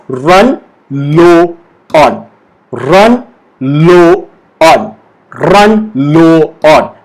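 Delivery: very slow, separated words; slowly. Shown as a demonstration. Teacher has learners say it with her, slowly. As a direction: stretch out each word for repeating. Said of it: "say it with me slowly first".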